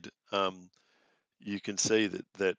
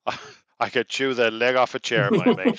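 hungry Irish captive, rising; Irish voice, ending on a high yelp; Irish traveller voice; 5 semitones higher, 12 dB louder than usual. Acted. Ned says he is starving.

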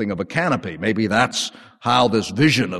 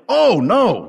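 high, loud startled "Oh, no"; alarmed refusal; 9 semitones higher, 4 dB louder than usual. Acted. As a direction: recoil in shock at the command. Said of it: "Peter responded instinctively".

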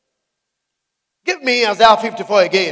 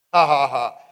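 laughing; 8 semitones lower, 3 dB quieter than usual. Real, not acted. Preacher laughs after taunt.

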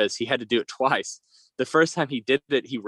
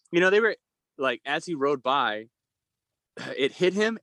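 ordinary talk, nothing marked

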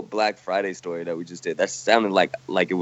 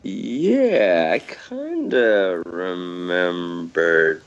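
very slow, stretched-out recall; slow-motion stoned reminiscing; stoner drawl; 4 semitones lower, much slower than usual. Pretend. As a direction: drag every word out hazily. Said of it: "eighteen years of smoking weed, dude".

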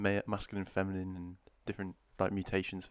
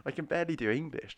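high-pitched mock protest; exasperated; 7 semitones higher, 6 dB louder than usual. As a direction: protest in comic disbelief. Acts out own exasperated reaction.

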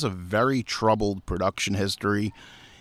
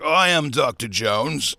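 slow, grand mock Thor; hammed-up Thor impression, drawn out; 2 semitones higher than usual. Actor botching Indiana Jones with his accent, mock Thor voice. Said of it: "do the Thor voice".

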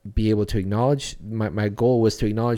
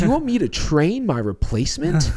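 a mock disbelieving cry, much higher and louder; incredulous outburst; 8 semitones higher, 4 dB louder than usual. Telling the interviewer his gut reaction, in hammed-up disbelief. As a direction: play the outrage of being asked to train your replacement. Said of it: "the gut in the world reaction would be like".

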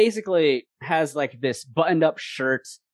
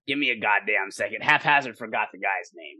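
ordinary delivery

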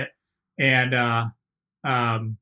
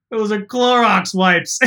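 1 slow deadpan reveal; 7 semitones higher, 7 dB louder, slower than usual. Retold or imitated. Mark's deadpan punchline.

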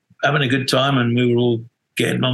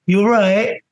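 high, louder mock check-in with rising end; pointedly obvious concern; 9 semitones higher, 4 dB louder than usual. Bandleader needling his hungover drummer, pointedly obvious high query. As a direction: imitate someone deliberately making you squirm. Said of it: "making it more obvious".

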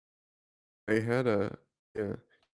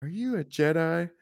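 lightly voiced child's question, pitched higher; wide-eyed movie kid asking, higher lilt; Anakin; 8 semitones higher than usual. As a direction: ask it high, like a curious little boy. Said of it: "Anakin goes up to, uh, Qui-Gon and is like".

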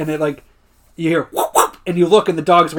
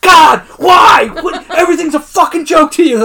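high crying yell at full volume; anguished shout; 11 semitones higher, 10 dB louder than usual. Retold or imitated. Jim yelling at Dwight in anguish. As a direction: scream it like you're breaking. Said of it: "Like, in that same tone".

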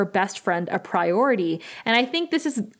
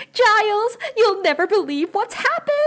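mock giddy excitement; 12 semitones higher than usual. Engaged Buffy calling to Giles, mock giddy excitement. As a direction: imitate a giddy bride-to-be with big news.

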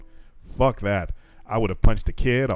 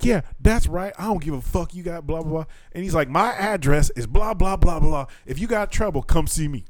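high, taunting brag; mock bravado; 6 semitones higher, 3 dB louder than usual. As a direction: dare them all, high and cocky.

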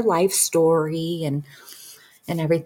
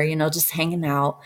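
ordinary talk, nothing marked

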